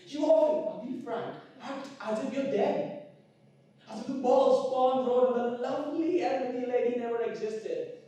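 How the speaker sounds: high, loud, mounting rant; impassioned accusation. Acted. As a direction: build to a ringing shout on the last words. Tirade climaxes in accusation.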